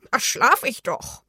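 put-on croaky crow voice, put out; croaking crow. A narrator's reading. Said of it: "krächzte die Krähe". (krächzende, beleidigte Krähenstimme)

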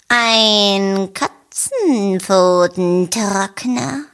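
slow, drawn-out cat voice. A narrator's reading. Lucia the cat naming it, slow and lazy. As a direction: savour each syllable like a cat. Lucia names her use.

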